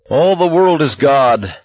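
high, loud, slow mock proclamation; drawn-out manic preacher; hysterical giddiness. A narrator's reading. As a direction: proclaim it giddily, pitched well up. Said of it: "giggled hysterically".

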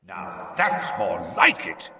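slow gruff growl; Moody growling. Acted. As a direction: growl it with grim approval. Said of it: "growled Moody's voice".

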